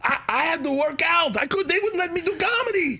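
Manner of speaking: high-pitched mock Austrian accent; Arnold Schwarzenegger. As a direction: keep the hammed-up Austrian accent going. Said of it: "That's what Arnold kept saying".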